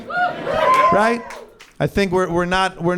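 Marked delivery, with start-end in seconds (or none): 0.1-1.2 laughing right. Real, not acted. Seeks crowd agreement, laughing.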